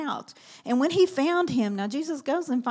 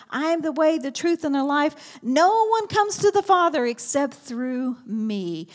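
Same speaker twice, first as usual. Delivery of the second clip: louder, higher preaching; preaching proclamation; 4 semitones higher, 5 dB louder than usual. Acted. Preacher proclaiming Jesus's words to the congregation, louder. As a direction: proclaim it with force.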